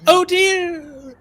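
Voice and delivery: high, loud "oh dear" cry; comic distant cry. Acted. Game master voicing the thrown sword, comic high cry. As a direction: call it out high and drawn out, amused. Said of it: "You just hear".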